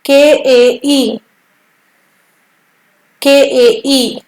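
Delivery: के ए ई spelled out slowly, letter by letter; slow spelling. Shown as a demonstration. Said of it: "इसका English उच्चारण होगा के ए ई". (धीरे-धीरे के ए ई)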